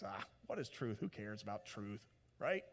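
low, soft cynical shrug; cynical Pilate. Retold or imitated. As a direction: dismiss truth with a sneer. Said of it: "Pilate goes".

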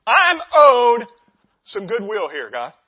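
loud, entitled demanding; barging demand. Acted. Entitled believer barging in on God, loud demand. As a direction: lay claim loudly, as if owed. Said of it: "You come barging in the doors".

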